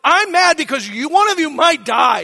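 loud, high shout; frantic dad yelling at crying kids; retold panicked shout. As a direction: shout in fear for their lives. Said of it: "I'm shouting at them".

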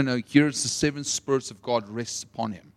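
usual speaking voice

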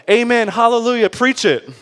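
high, loud church crowd shouts; mock cheering congregation. Twenty thousand people answering the pastor, mock fervent cheering. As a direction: imitate a crowd egging on the preacher. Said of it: "twenty thousand people were like".

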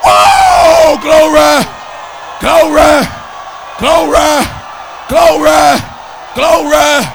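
loud, high exultant shouts of glory; full-force preaching. Real, not acted. Preacher praising God before the congregation, full-force exultant shouting.

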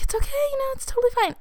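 imitating an awkward, very high "all fine"; high-pitched reassurance. Girl to her lingering boyfriend, high-pitched awkward reassurance. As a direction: play it off in a high, small voice.

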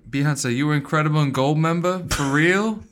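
high, loud put-on rejection. Co-host plays the departed fan to his wife, mock disowning. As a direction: disown the show with comic gusto. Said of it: "now he's completely out".

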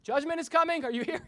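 teacher calling the name; roll call mock. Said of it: "It's like".

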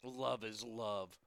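low, soft, slow repeat of the quote; subdued scornful echo. Retold or imitated. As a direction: echo it under your breath, unimpressed.

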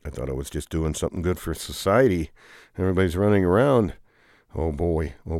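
ordinary delivery

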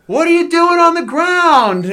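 high, loud jeering brother; mocking yell. Brother jeering at him lying on the ground. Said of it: "Jeff, goes".

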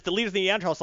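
ordinary delivery

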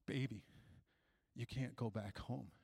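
very low, soft, halting; grave grandma. Retold or imitated. Grandma telling the child he can't go home, hushed and ominous.

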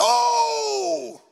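long high howl, falling at the end; mock yell of sudden pain; imitated cry of pain. Preacher acts out witch doctor struck by his curse, long howl.

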